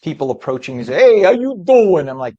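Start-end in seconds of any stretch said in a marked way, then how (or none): 0.9-2.1 loud, drawn-out Italian-American greeting, much higher; mock Italian-American accent. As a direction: imitate strangers putting on a hammed-up Italian-American accent. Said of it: "that accent".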